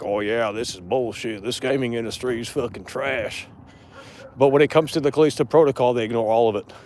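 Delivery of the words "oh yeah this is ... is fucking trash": muttered mock gamer gripe, lower and much softer; dropped-voice sarcastic quote; mock complaint. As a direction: sneer it under your breath like a fake fan. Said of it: "they wanna play like".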